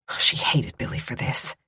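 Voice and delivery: breathy hushed narration, seething; hushed hatred. A narrator's reading. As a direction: let the hate slip out under your breath.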